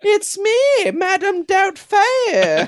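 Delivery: high falsetto Scottish nanny voice, loud; hammed-up Scottish accent in a shrill old lady's falsetto; Scottish Madam Doubtfire. Seducing Scott after time travel, in a loud falsetto Scottish accent. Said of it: "my Scottish accent".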